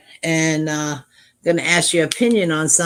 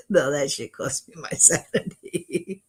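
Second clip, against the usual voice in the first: laughing. Real, not acted. Laughs while answering the chat.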